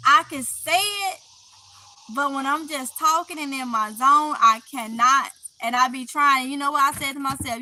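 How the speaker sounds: normal talking voice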